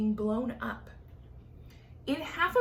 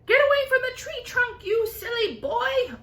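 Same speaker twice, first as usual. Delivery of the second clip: high, loud scolding yell; angry yell; 12 semitones higher, 7 dB louder than usual. Acted. Aunt yelling at James to leave the trunk, angry. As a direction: bark it up high at the boy. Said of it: "Aunt Spiker yelled".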